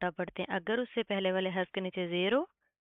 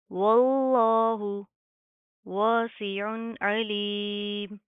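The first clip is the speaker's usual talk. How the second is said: slow, loud chanted tajweed; measured melodic Quran reading; Quranic recitation; 5 dB louder, much slower than usual. Shown as a demonstration. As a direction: draw out every syllable. Continues recitation.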